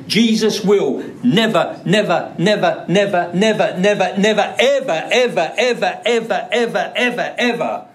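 preacher driving home a double negative; emphatic repetition. Shown as a demonstration. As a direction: pile up the nevers and evers for emphasis.

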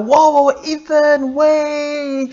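put-on loud high "whoa, wait" to stop a child; calling out. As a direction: call your son back from the street. Father stopping son near cars.

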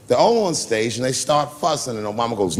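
ordinary talk

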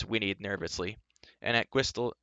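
ordinary delivery

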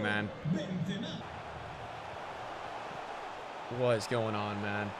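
low, soft and fed up; defeated moan. Real, not acted. Defeated gamer moaning.

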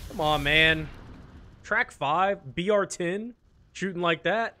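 ordinary talk

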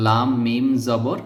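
patient letter-by-letter spelling; slow spelling. Shown as a demonstration. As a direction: spell slowly, one letter name at a time. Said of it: "এভাবে পড়তে হবে". (ধীরে বানান)

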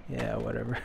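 usual speaking voice

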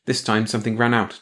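ordinary delivery, nothing marked

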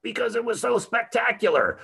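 very high-pitched, exuberant burst; gushing. Acted. Lecturer answering their own question about the garden, gushing. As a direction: answer with over-the-top wonder, voice soaring.

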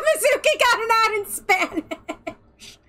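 asked high through laughter; words breaking into laughter; laughing. Real, not acted. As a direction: laugh the question out. Laughing at a friend's ad.

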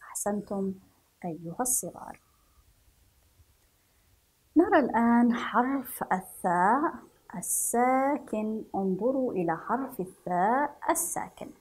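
ordinary talk, nothing marked

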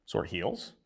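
a questioning repeat, rising at the end; incredulous echo. Retold or imitated. Host double-checking the injury he just named, rising. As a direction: repeat it back as if you misheard. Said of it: "Just slipped out".

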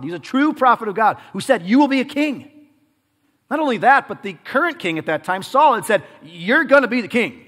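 driving, high-energy sermon delivery; forceful preaching. Real, not acted. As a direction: press each point on the congregation, high and loud.

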